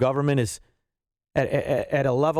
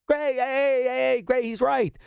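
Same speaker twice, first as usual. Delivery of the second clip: mock cheering fan; 10 semitones higher, slower than usual. Mocking Trump fans cheering for his bumper-sticker line, high whoop. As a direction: ham up a cheering Trump supporter.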